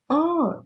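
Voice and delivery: "Ó" sprung high as a short exclamation; a bright, high "Ó!" showing surprise; exclaimed. Shown as a demonstration. Teacher demonstrates an exclamation to students, a high exclaimed "Ó". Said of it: "da entonação".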